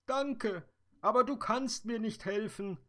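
a hint of sobbing in a high voice; sobbing fairy. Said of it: "schluchzte die Traumfee".

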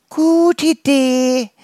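high, loud, slow twittering bird voice; chirping birds. Acted. Birds chirping their approval to the dandelions, high and loud. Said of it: "zwitscherten die Vögel".

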